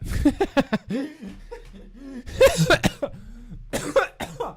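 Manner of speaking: long high helpless laugh; laughing hard. Real, not acted. Streamer losing it after the "that" chant, laughing. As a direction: crack up and can't stop.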